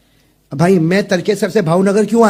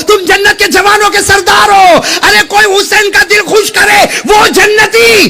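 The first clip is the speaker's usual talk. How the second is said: high shouted proclamation; fiery preaching; 12 semitones higher, 7 dB louder than usual. Acted. Preacher proclaiming the Prophet's praise of young Husain, fiery preaching. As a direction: cry out the praise at the top of the voice.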